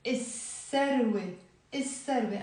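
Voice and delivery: الثروة as is-serwe with a long S; silent lam. Shown as a demonstration. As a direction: drop the L and double the S in الثروة. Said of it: "ما بنلفظ اللام".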